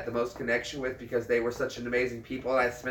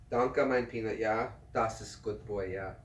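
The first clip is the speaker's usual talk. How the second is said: much softer, loving German to the dog; tender German; 3 semitones lower, 9 dB quieter than usual. Shown as a demonstration. Telling his dachshund he loves him in German, softer and tender. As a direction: murmur love to him, fading away. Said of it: "You want me to speak German to my dog?".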